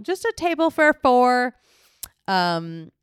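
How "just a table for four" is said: high, loud restaurant request; mock table request. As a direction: chirp it out, voice raised high.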